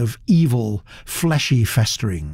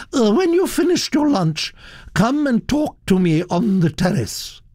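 commanding; 10 semitones higher than usual. Acted. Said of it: "ordered the Duchess".

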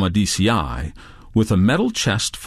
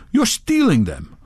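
mildly acted accusation, pitch raised; accusing; 7 semitones higher than usual. A narrator's reading. Redbeard accusing Touré of theft, mildly accusing. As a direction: accuse him, lightly in character. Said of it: "said Redbeard".

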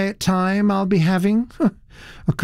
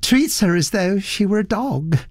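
melancholy murmur; 9 semitones higher than usual. A narrator's reading. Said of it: "murmured the melancholy voice".

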